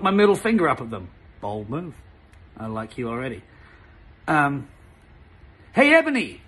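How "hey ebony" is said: higher, louder call across the yard; hammed-up shout; mock shout. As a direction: call out her name. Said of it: "shouted a voice".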